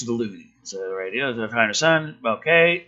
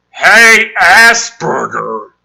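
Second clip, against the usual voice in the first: loud taunting yell; bully shout; 4 semitones higher, 12 dB louder, much slower than usual. Acted. Bully yells a taunt at Rory. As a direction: shout the insult across the street.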